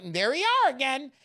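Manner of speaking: ordinary delivery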